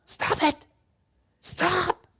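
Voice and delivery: exasperated wife, squeaky plea; wife's pleading stop. Retold or imitated. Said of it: "wants to look at the T V and say".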